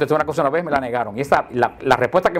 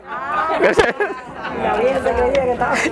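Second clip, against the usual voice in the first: high laugh; 9 semitones higher than usual. Real, not acted. Se ríe contando el chiste.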